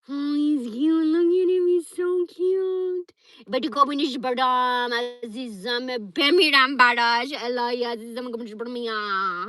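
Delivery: high, loud cooing at a pet; gushing pet talk. Real, not acted. Gushing over her dog.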